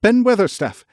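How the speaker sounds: high, loud gasped call; girl calling up the wall; breathless call. A narrator's reading. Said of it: "called up to him with a sort of gasp".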